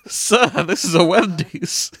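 high, slow, laughing retort; laughing meme comeback; mock staff reply.

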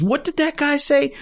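high-pitched indignant question; mock offence, voice jumping up high; kinda mad. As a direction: imitate a riled drunk demanding to know. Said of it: "Like he's kinda mad".

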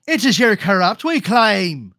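loud, drawn-out, hammed-up bad Irish accent; awful fake Irish, overdone; terrible Irish accent. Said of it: "worst Irish accent".

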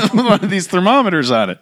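high-pitched, talking through laughter; laughing. Real, not acted. Priest cracking up describing a colleague's holstered thermometer, laughing. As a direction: giggle your way through the words. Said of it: "that's why I'm laughing".

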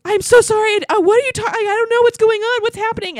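high, loud, flustered babble; hammed-up breathless freak-out; mock hyperventilating panic. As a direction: imitate him panicking and apologizing at once.

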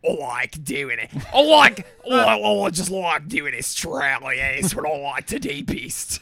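low, broad mock Australian; hammed-up, badly faked Australian accent; mangled Australian accent. Shown as a demonstration. Showing co-host a favourite accent, low mock Australian. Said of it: "I like doing a French".

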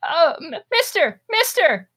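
put-on high, squeaky little boy calling out; high-pitched pleading kid voice; tiny child voice. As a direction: call for a man's attention as a small kid. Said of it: "like, a tiny voice say".

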